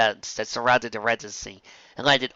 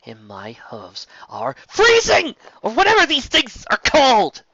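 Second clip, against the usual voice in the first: loud, slow complaint rising high; loud shivering whine; cold complaint; 12 semitones higher, 7 dB louder, slower than usual. A narrator's reading. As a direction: whine loudly about freezing hooves.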